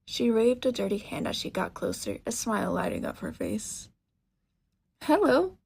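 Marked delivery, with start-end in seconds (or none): 5.0-5.6 bright, high and loud hello; cheerful greeting. Acted. Shopkeeper greets a teen customer, cheerful and loud. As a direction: call out a cheery welcome. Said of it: "Her voice was cheerful".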